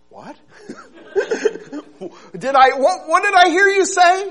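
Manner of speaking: high, loud incredulous question with a laugh; can't believe what you heard; shocked disbelief. Acted. Man stunned by God's answer, disbelieving. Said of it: "the man said".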